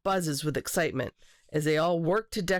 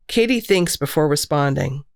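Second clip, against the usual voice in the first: ordinary delivery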